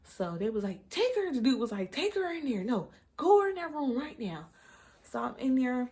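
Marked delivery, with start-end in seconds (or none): 0.9-1.3 very high imitated staff call; mimicked urgent order. Staff sending labouring woman to a room, mimicked urgency. 1.9-4.5 high, insistent imitated command; mimicked urgent order. Hospital man sending her into the room, mimicked urgency.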